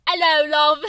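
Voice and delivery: hammed-up British accent, loud; mock British accent. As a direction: croon it in a thick fake British accent. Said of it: "you have".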